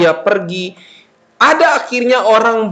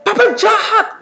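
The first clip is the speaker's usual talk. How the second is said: high, whiny accusation; bratty child; 14 semitones higher than usual. Acted. Child answers papa back rudely, high whine. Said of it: "Jangan bilang gini".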